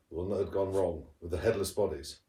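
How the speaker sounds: usual speaking voice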